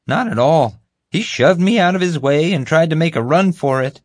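high, eager, self-important voice; bumbling guard. Acted. Guard recounting the chase to the group, eagerly. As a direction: pitch it up and boast through the story.

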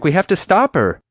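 high, loud, urgent protest; shouting back. A narrator's reading. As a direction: insist at full voice. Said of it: "I shouted back".

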